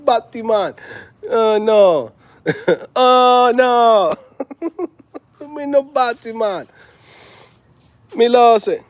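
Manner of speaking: high, loud Jamaican patois, laughing through it; hammed-up Jamaican voice; Jamaican accent. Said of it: "when I go to Jamaica".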